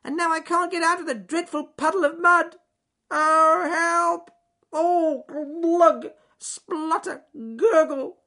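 loud high wail, spluttering as it sinks; frantic squirrel. Acted. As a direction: wail for help, then gurgle into the mud. Squirrel sinking, calling for help.

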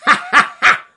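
loud, high mocking fox laughter; shouted laugh. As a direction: crow with triumph like a villain fox. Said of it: "shouted Ray Deadly Fox".

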